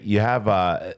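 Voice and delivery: ordinary talk, nothing marked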